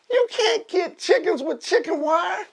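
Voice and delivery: very high scolding holler; mock yelling old man. Old man scolding boy about chickens, high mock yell. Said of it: "He's yelling at him".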